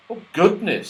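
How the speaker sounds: a touch of alarm; mildly startled gasp. Acted.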